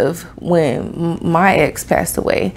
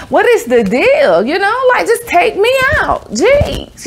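much higher, exasperated whine; exasperated plea; 12 semitones higher, slower than usual. Retold or imitated. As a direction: plead up into the sky. Pleading with cars in traffic.

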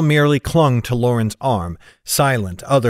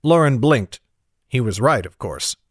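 ordinary delivery, nothing marked

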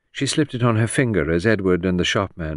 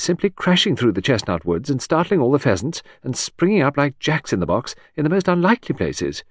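lilting high society lady; chattering aristocrat; 6 semitones higher, 3 dB louder than usual. Acted. Hostess prattling to Edward about the wedding. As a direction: prattle on brightly, higher.

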